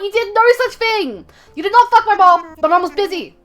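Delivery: high, loud, playful protest; mock-indignant denial. Streamer rebutting a chatter's mom joke, mock-indignant. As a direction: protest loudly in mock outrage.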